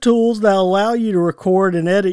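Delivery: bright, upbeat ad read. Acted. Host reading a podcast platform ad to listeners in an upbeat voice. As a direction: sell it like a podcast sponsor spot.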